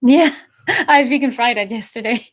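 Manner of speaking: usual speaking voice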